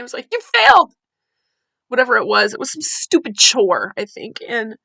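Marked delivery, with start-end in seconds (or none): 0.3-0.9 furious shout, much higher and louder; real rage yelled at a daughter; screamed. Retold or imitated. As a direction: scream it at your kid. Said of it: "and I just screamed".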